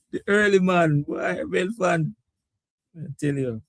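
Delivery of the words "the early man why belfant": ordinary talk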